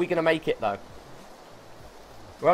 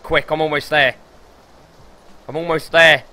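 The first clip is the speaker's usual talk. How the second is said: louder urgent push; urgent call; 2 semitones higher, 7 dB louder than usual. Real, not acted. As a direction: urge yourself on, almost there. Rushing to zone.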